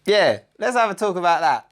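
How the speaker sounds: delayed accusing confrontation; mock confrontation. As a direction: bring it up with a knowing edge. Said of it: "to go".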